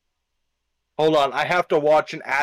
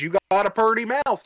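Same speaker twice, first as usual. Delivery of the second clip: big prison inmate; 7 semitones higher than usual. Acted. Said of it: "When the big man in prison says".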